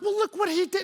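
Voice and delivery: high, pleading self-justification; defensive whine. Retold or imitated. Husband justifying himself to his wife, high and whiny. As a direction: protest like a sulky kid. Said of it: "I was like".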